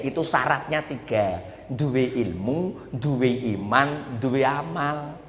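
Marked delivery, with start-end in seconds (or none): 1.7-5.2 very slow, each "duwe" stretched and stressed; slow emphatic listing. Shown as a demonstration. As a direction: spell out "duwe ilmu, duwe iman, duwe amal" word by word. Preacher lists the three conditions.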